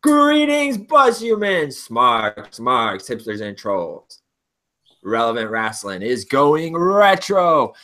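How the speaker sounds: booming announcer promo voice; wrestling promo. Acted. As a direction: hype it like a ring announcer. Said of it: "it'll be like this".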